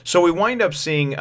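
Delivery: ordinary talk, nothing marked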